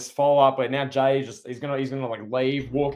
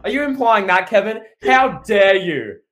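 louder and higher, hammed-up offended demand; mock outrage; 5 semitones higher, 6 dB louder than usual. Mock outrage at co-host's comparison.

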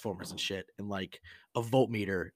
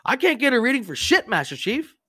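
high, loud, frustrated yell down from above; a hapless young sailor calling down, fed up; frustrated seaman; 14 semitones higher, 10 dB louder than usual. Retold or imitated.

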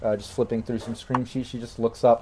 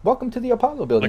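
high, fast cheerful greeting; chirpy robotic receptionist; 11 semitones higher, faster than usual. Acted. AI receptionist speaking.

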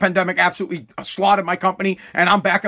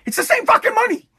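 exasperated outburst; 12 semitones higher than usual. Real, not acted. Ranting at viewers, exasperated high outburst. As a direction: pitch jumps way up on fucking, exasperated. Ranting about wage rises.

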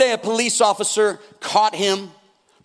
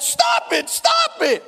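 very high, squealing plea; hammed-up falsetto begging; mock panicked cry; slower than usual. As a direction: yelp it like a man being beaten. Beaten man cries out in story.

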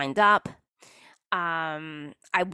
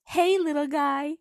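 higher, slow, sweet greeting to a tiny thing; cute cartoon greeting; 8 semitones higher, slower than usual. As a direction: coo it like talking to a little fish. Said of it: "And it goes".

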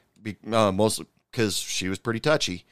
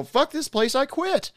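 high-pitched mock outburst; imitating the fired server. Fired waitress storming out, high and tearful. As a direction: pipe up high like an upset young waitress.

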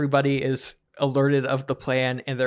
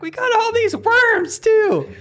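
very high squeaky skeleton glee; gleeful skeleton; 6 dB louder, slower than usual. Acted. Skeletons gloat over piles of bones, squeaky and high. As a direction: squeal with greedy delight. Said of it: "He's like".

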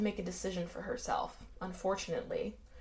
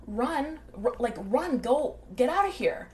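higher, louder urgent cry; urgent plea; 4 semitones higher, 4 dB louder than usual. Retold or imitated. Marin urging Annette to run from danger, urgent raised voice. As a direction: urge her to flee right now.

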